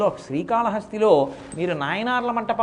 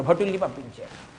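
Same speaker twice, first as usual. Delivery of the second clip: normal talking voice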